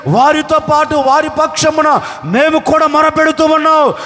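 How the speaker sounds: shouted, high pleading prayer; crying prayer. Acted. Oxen crying out to God for their masters, shouted plea. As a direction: cry out to God from the field. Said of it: "అని ఎడ్లు వాళ్ళిద్దరు కలిసి ఏడుస్తుంటేనండి".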